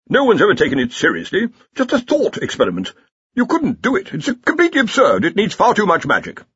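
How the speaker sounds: high, scoffing academic; dismissive Dean. A narrator's reading. Dean in story.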